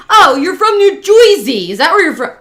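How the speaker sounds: loud, high mock Joisey accent. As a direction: mimic everybody's Joisey accent with scorn. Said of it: "And then everybody".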